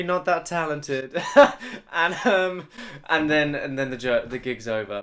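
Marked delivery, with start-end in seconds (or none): 0.0-2.7 slow, laughing through the words. Real, not acted. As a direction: laugh while admitting it. Laughing at own self-doubt.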